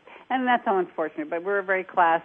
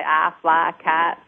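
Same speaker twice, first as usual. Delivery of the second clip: slow, louder Southern drawl; Southern accent demo, drawn out; 5 dB louder, much slower than usual. Shown as a demonstration. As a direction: stretch "fly kites" into Southern "ah" vowels. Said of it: "to lose their southern accent".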